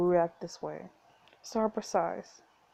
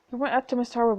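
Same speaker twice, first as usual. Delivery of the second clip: ordinary talk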